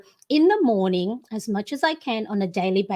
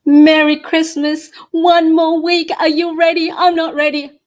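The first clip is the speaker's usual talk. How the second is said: loud, higher-pitched show opener; excited greeting; 5 semitones higher, 11 dB louder than usual. Real, not acted. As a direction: call out a big cheery hello to the audience. Livestream opening greeting to viewers.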